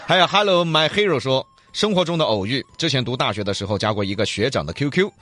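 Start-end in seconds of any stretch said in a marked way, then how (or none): none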